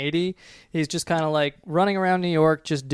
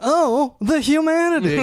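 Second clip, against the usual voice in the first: mock dramatic lament; 12 semitones higher, 4 dB louder than usual.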